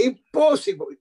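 high, mock-indignant refusal; emphatic refusal. Agent refusing a promoter's request, emphatic refusal. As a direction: throw up a retold refusal, pitched high.

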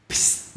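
Psst as an isolated voiceless ending of lamps; final ps sound. Shown as a demonstration. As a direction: release just a p into a long s. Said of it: "Nhớ âm cuối". (Psst, chỉ bật âm cuối ps)

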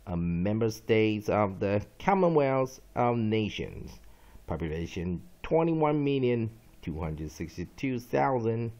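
slow, loud, measured definition read-out; dictionary reading. Acted. Teacher reading a vocabulary entry to students, slow dictionary reading. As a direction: read it out emphatically, phrase by phrase. Reads dictionary entries aloud.

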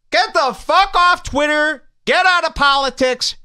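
urgent pleading shout. Real, not acted. Host yelling at listeners to quit politics, urgent shout. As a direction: yell at them to quit Twitter.

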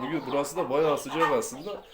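ordinary talk, nothing marked

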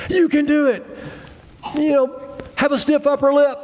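high-pitched mock pep talk; cheerleading coach. Pretend coach urging someone through a problem, high mock cheering. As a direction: play a chirpy little coach, pitched well up. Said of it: "you know, coaching you".

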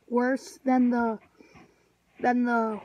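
jar pressed to mouth; talking into a jar. Real, not acted. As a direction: mouth against glass rim. Seen 0: No chewing visible; jar held against mouth and nose mid-speech.

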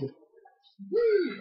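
high gleeful whee. Real, not acted. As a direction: squeal with joy on the jump. Jumping in the game.

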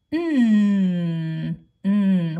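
"mm," as a long, loud held hum; letter sound. Shown as a demonstration. Teacher asking which letter says it, long held hum. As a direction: hum "mm," firmly and hold it. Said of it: "What letter says mm?".

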